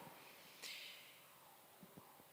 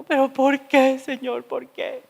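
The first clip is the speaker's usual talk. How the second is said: pleading why, first word pushed up loud; a whiny protest to the Lord; complaining to God; 4 semitones higher, 5 dB louder than usual. Retold or imitated. Retelling her complaint to the Lord, plaintive. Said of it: "Reclamándole al Señor".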